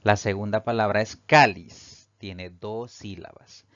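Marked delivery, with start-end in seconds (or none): 1.1-2.1 CÁ of "cáliz" punched louder; "cáliz" with a strong first syllable; penultimate stress. Shown as a demonstration. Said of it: "La mayor fuerza de voz al pronunciarla recae".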